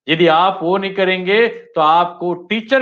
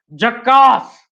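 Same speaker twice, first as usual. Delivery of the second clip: Anil Kapoor catchphrase; 3 semitones higher, 5 dB louder, slower than usual. Shown as a demonstration. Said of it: "आपके सामने आ जाता है अनिल कपूर".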